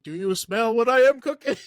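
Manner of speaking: loud Rock catchphrase; hammed-up wrestler boast; The Rock impression. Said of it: "You go to ancient times".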